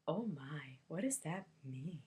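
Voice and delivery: a hint of a prim, startled mother; shocked mom. Conservative mom reacting, voiced in story.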